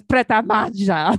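Pret a Manger in a slow Italian lilt; imitated Italian accent. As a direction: mimic her Italian-sounding "Pret a Manger", mahn-ZHA. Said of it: "She says it in Italian".

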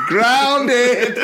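high, drawn-out cry with a laugh; mock angry shout. Retelling grounded teen coming out angry, mock shout. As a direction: bellow it in hammed-up anger. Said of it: "just looking angry".

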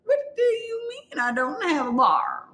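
can't believe the bar is gone; indignant disbelief. Retold or imitated. To the staff, indignant there's no bar.

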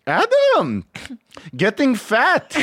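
high, loud drawn-out greeting; tough Israeli dad. Retold or imitated. Friend's dad at the door, teasing mock holler. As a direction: imitate a blunt Israeli dad hollering hello. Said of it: "hardcore Israeli tough guy".

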